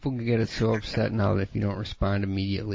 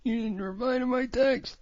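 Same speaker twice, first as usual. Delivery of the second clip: higher whining, wah; whiny texter; 10 semitones higher than usual. Retold or imitated. Friend mocking a needy texter whining about replies. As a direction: whine about no reply.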